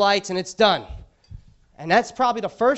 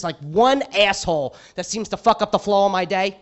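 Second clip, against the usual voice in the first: normal talking voice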